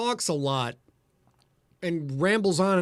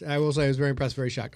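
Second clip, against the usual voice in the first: usual speaking voice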